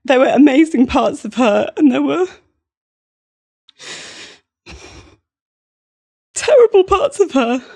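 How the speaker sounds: high, squeaky voice on the edge of tears; fighting back tears, pushing the words out; tearful squeaky voice. Real, not acted. Telling a podcast host about her mum, near tears. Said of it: "I have a really squeaky voice when I cry".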